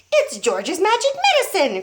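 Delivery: put-on an old grandma boasting; grandma shouting. A narrator's reading. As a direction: crow it proudly. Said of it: "Grandma shouted".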